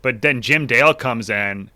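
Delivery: ordinary talk, nothing marked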